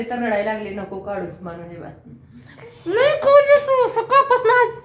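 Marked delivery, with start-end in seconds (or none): 2.9-4.8 exasperated mother telling viewers about the pants. Real, not acted.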